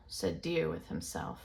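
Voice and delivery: ordinary delivery, nothing marked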